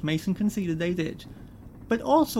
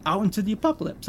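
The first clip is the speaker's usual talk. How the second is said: sharp, incredulous retort; snapped; 5 semitones higher than usual. Acted. Richard snapping at Mason about being dumped outside. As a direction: throw the question back at him. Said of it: "I snapped".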